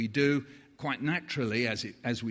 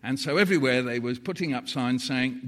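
usual speaking voice

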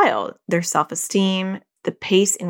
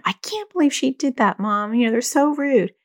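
higher-pitched imitation of an upset teen; upset daughter; 6 semitones higher than usual. Daughter venting to her mom after school, mock upset. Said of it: "she was so upset".

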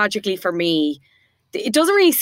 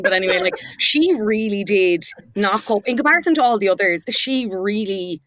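ordinary talk, nothing marked

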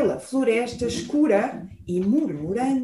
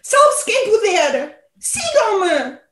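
urgent shout; 6 semitones higher, slower than usual. Acted. Bear urges escape.

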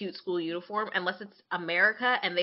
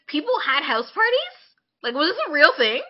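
mock disbelief; 8 semitones higher, 9 dB louder than usual. Real, not acted. Mock disbelief about parties.

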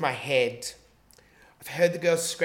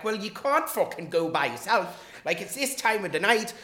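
imitated Irish girl, higher-pitched; Irish accent; 6 semitones higher, 4 dB louder than usual. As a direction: put on an animated Irish accent. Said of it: "this Irish girl's like".

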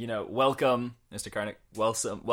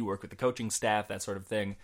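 ordinary talk

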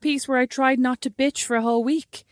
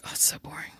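breathy whisper, much softer; 7 semitones lower, 11 dB quieter than usual. Retold or imitated. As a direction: whisper it as a disappointed aside. Whispered retort in retold text.

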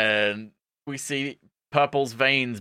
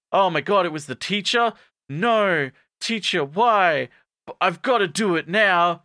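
high, melodramatic dismay; overacted tragic lament; mock anguish; 7 semitones higher, 4 dB louder than usual. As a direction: plead in hammed-up horror. Acting horror at zombie teacher.

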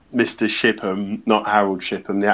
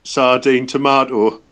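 put-on Geordie accent. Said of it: "do them again in the Geordie accent".

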